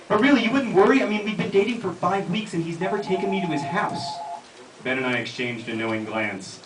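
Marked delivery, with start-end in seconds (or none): 0.1-4.0 Angela's higher voice. Acted. Angela voiced in read scene.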